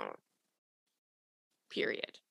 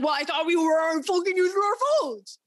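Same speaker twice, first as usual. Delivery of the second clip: put-on annoyed boyfriend; 5 semitones higher, 3 dB louder than usual. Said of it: "he would be like".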